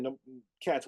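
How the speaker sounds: ordinary delivery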